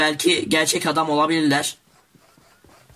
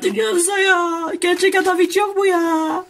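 much higher, yelling at the bots in frustration; exasperated shout; 14 semitones higher than usual. Real, not acted. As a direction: scream it out, sick of fighting bots. Fed up with endless bots. (botlardan bıkmış, çok tiz ve bağırarak)

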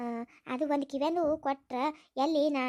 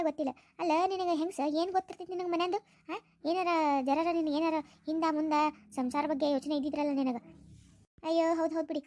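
normal talking voice

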